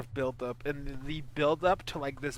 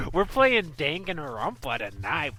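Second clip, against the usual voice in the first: ordinary delivery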